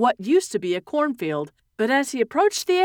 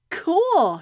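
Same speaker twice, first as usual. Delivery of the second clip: put-on higher-pitched "boy's" exclamation; excited exclamation; 7 semitones higher than usual. A narrator's reading. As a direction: exclaim it in delight at a find. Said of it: "he exclaimed".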